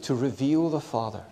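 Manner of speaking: usual speaking voice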